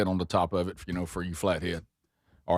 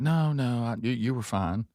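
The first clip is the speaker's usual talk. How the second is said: voiced Mom, higher, brushing it off; mock reassurance in a higher voice; 6 semitones higher than usual. Mom reassures Sherry about the chair, higher mock voice.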